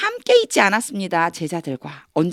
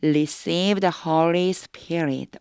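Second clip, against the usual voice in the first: "Receive the Holy Spirit" read very slowly; 3 dB quieter, much slower than usual. Shown as a demonstration. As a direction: spell each word out slowly for the class. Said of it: "자, 해볼게요".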